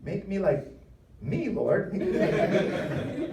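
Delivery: high, laughing mock prayer; comic pleading. Preacher begs the Lord to leave him as he is, comic high plea. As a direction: beg in a high voice, breaking into laughter. Jokingly begs to stay himself.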